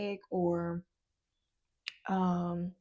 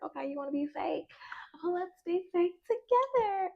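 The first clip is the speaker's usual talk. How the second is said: mock sweet fake voice; 9 semitones higher than usual. Same mocking quote again.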